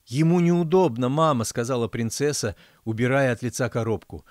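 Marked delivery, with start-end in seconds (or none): 0.0-1.4 1 anxious plea. A narrator's reading. Princess defending lover.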